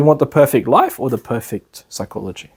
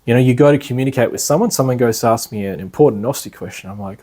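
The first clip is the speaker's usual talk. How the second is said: ordinary talk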